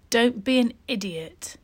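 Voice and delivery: ordinary delivery, nothing marked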